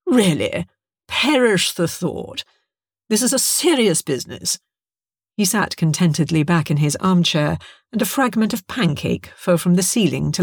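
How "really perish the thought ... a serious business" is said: infuriatingly pleased with himself; mock-innocent, smugly dismissive. A narrator's reading. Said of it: "with typical infuriating smugness".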